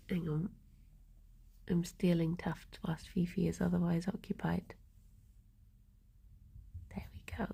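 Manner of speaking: soft whisper so the rabbit won't notice. Real, not acted. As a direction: hush it, sneaking fur off the bunny. Sneaking fur from busy rabbit.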